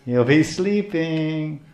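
very slow, drawn-out mock drowsiness; sleepy drawl. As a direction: stretch it out as if nodding off. Mocks fasting devotee's sleepy bhajan.

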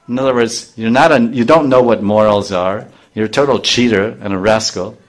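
ordinary talk

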